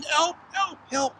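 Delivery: mock screaming. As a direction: squeal like a storybook girl being chased. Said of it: "started to run around screaming".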